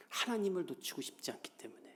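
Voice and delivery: whispered explanation. Acted. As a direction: lean in and whisper it like a secret. Preacher explains Hannah's motive.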